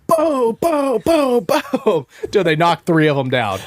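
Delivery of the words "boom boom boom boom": high, punchy shotgun booms; imitated gunshots. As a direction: mimic a volley of shots. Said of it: "it's just like".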